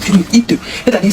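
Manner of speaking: usual speaking voice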